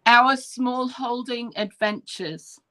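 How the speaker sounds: channel name spaced out slowly; slow, careful repeat. Shown as a demonstration. Guest repeating the channel name on request, slow and careful. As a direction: say it slowly for someone taking it down. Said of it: "Say the name one more time".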